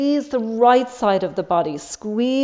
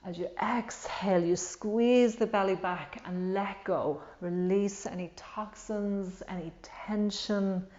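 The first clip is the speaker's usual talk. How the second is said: guided meditation; 3 semitones lower, 7 dB quieter than usual. Acted. Leads a guided breathing meditation.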